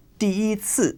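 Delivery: 第一次 with 一 kept high and level; first tone yi. Shown as a demonstration. Teacher explaining an exception to learners, 一 level. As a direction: hold 一 flat in 第一次. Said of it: "yi would stay as a first tone".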